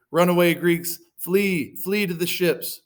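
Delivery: loud, high call to retreat. Acted. Weeping Agamemnon orders the Greeks to flee, high loud call. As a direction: urge them away with an acted cry. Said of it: "Agamemnon called the Greeks".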